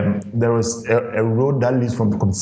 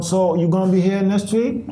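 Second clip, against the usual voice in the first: normal talking voice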